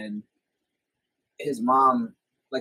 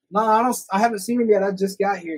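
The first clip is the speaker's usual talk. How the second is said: higher, put-on innocence; re-enacted innocent fib; 8 semitones higher, 3 dB louder than usual. Visitor lies to mom for his hiding friend, innocent. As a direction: play dumb to cover for a friend.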